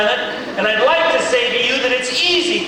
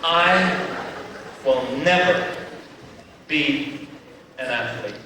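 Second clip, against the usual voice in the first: slow, falling, pause-laden confession; slow mock gravity; 7 semitones lower, 5 dB quieter, slower than usual. Comic speaker's mock solemn confession to the crowd, slow and falling. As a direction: draw it out, each word a step down. Slow dramatic closing line.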